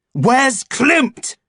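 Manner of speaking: high furious demand; raging. Acted. Hurt agent rages at helper, high and furious. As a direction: shout it in a rage. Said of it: "I raged".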